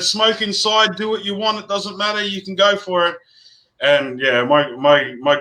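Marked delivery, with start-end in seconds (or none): 0.0-3.2 higher, light imitation of a doting old man; lightly voiced grandad giving in happily; indulgent grandfather. Grandfather telling sick daughter she may smoke indoors, mock doting and high. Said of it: "So he said".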